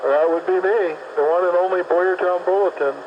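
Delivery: ordinary talk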